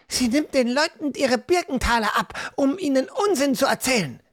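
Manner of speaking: high, fast indignant rant; girl furious at the fortune teller; angry girl. Acted. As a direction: rattle off the accusation in fury. Hannah angrily accuses fortune teller.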